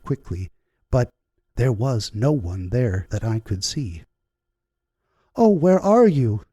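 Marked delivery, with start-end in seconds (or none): 5.4-6.4 high, loud cry of panic; panicked cry. A narrator's reading. Woman losing her unseen guide, crying out in panic. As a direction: call out in sudden fear. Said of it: "I cried".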